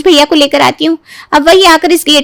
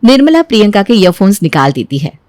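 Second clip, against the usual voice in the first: ordinary talk, nothing marked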